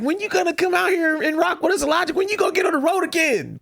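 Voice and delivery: high-pitched imitation of insistent fans; imitated eager fans. Host relaying Dallas fans' question to co-host, imitated pleading. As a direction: pester like a fan, voice way up.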